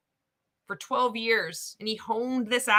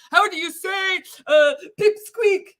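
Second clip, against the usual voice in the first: higher, louder mock character voice; mimicked speaker; 6 semitones higher, 6 dB louder than usual. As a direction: drag out the punchline word, loudly. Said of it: "and we talk".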